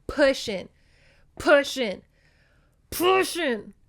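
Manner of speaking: mock effort, building; straining effort. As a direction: strain as if shoving a heavy cow. Straining, pushing the cow.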